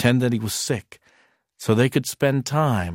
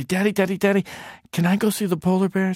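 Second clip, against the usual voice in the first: put-on high, fast little boy pleading with his dad; child begging; 8 semitones higher, much faster than usual. Little boy begging his father to see polar bears, high and quick. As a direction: pipe up like an eager small boy.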